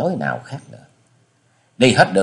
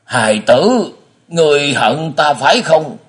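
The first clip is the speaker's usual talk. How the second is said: put-on high-pitched, pained old man's question; faltering; 6 semitones higher, 4 dB louder than usual. A narrator's reading. Blind father asks if his son hates him, faltering. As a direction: ask haltingly, voice raised in anguish. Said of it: "lại lên tiếng ú ớ hỏi".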